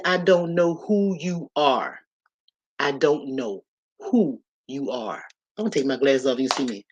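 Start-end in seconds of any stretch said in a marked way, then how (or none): 0.0-5.2 slow prayer. Retold or imitated. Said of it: "I said".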